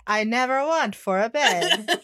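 slow, drawn-out delivery with amusement; John impression. Co-host showing how John would say the line, slow John impression. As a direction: stretch each word out slowly. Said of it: "say it like John and be like".

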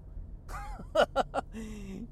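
soft, long chain of ha-has; chuckling laugh. Real, not acted. Podcaster after mimicking the unappreciative person, soft chuckling laugh. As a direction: laugh softly in a quick ripple.

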